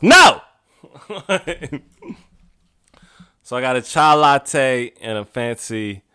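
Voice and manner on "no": sudden high, loud cry of no; comic yelped refusal; emphatic refusal. Acted. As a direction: blurt it out, appalled at the price. Refuses sixteen-dollar milkshake.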